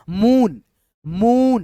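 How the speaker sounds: मून with a long, stretched oo; drawn-out vowel. Shown as a demonstration. Teacher showing students how long ऊ is read, drawn-out मून. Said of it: "खींच करके आएगा".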